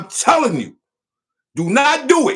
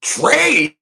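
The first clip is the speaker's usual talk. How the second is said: [name] voice; 3 semitones higher than usual. Retold or imitated. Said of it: "In my [name] voice".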